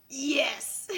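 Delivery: high, wheezing laugh; losing it completely; helpless high-pitched cackle. Real, not acted. Cracking up at the quote.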